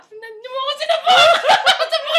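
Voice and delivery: loud high laughing protest; mock outraged shout, laughing; laughing complaint shout. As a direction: yell it through laughter. Complaining loudly while laughing.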